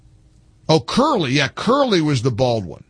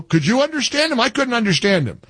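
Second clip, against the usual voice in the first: ordinary delivery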